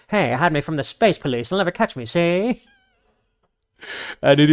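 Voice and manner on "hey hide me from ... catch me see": high, loud wise-guy gangster voice; brash old-time mobster talk; old-timey gangster. Retold or imitated. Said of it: "he speaks like an old-timey gangster".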